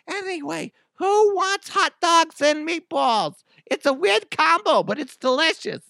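high put-on mom offering dinner; mama voice. Mom offering hot dogs and meatballs, high mock mama voice. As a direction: switch to cheery hostess in a cartoon mom pitch. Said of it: "when I do my mama voice".

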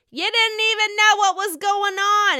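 high, loud mock accusation; Jennifer Aydin. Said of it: "Jennifer Aydin says".

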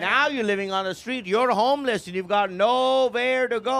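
put-on high, taunting accented mom; hammed-up rant with stretched ending; mock-angry mom. Mom declares son homeless, mock angry.

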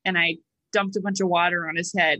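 normal talking voice

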